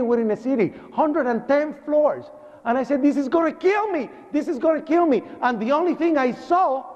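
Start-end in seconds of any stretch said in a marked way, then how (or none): 3.0-5.2 high, frantic retold fear; panicked. Survivor sure the collapse will kill him, high panicked voice. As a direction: voice the certainty of death.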